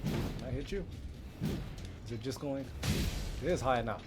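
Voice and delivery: ordinary delivery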